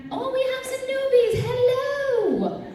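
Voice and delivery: very high squealed singsong welcome; high-pitched gushing hello; delighted greeting. Real, not acted. Greeting first-time con-goers.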